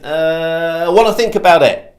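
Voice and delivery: lightly acted stalling customer; a touch of put-on hesitation; hesitant prospect. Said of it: "they're gonna come back with".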